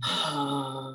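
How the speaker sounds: very low, soft open-throat uh; deep low tone. Shown as a demonstration. Showing the root tone again, a deep soft drone. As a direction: let a low uh out from the abs. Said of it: "And your throat is open".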